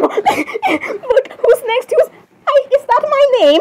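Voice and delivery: very high, excited squeal; giddy anticipation. Acted. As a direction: squeal it, hoping to be picked. Princess excited to be next.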